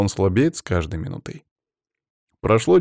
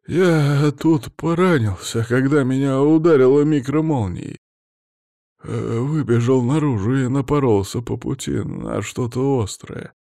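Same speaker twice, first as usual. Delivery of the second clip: higher, halting voice of someone hurt; injured man; 3 semitones higher than usual. A narrator's reading. Injured worker explaining his wound to rescuers, mumbling.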